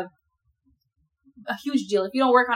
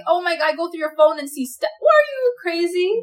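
appalled; 7 semitones higher, 3 dB louder than usual. Retold or imitated. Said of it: "acted discovery of phone".

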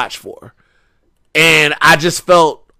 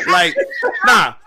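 ordinary delivery

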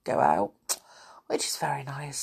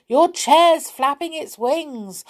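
crying out; 9 semitones higher, 5 dB louder, slower than usual. Acted.

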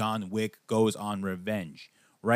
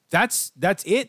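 mock disbelief; 6 semitones higher, 4 dB louder, much slower than usual. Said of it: "And I'm like".

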